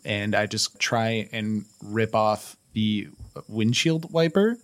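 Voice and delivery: ordinary talk, nothing marked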